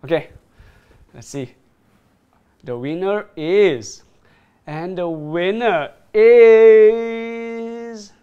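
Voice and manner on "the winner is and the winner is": slow, then louder and higher; milk the suspense; drawn-out suspense build. Real, not acted. Building suspense before winner reveal.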